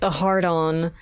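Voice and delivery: ordinary talk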